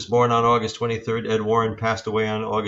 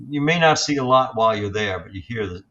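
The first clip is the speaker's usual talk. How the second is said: ordinary delivery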